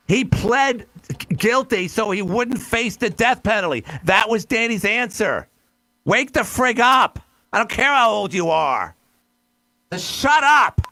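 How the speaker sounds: angry lecture, slow and forceful on wake the frig up; heated rant. Real, not acted. Host scolding caller after cutting him off, heated rant. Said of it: "I'm sorry for reacting this way".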